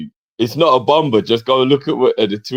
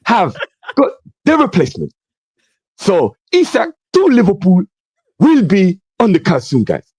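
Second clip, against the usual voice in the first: hyped reporter impression; 9 semitones higher than usual. Imitating the transfer reporter.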